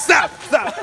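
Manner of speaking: high, loud through laughter; laughing shout. Real, not acted. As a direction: shout stop while cracking up. Laughing at friend drinking.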